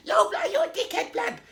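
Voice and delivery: very high, laughing youngster; squeaky cheeky kid taunt. Retold or imitated. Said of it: "you're saying".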